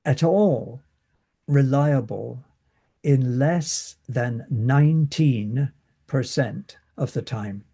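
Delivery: ordinary delivery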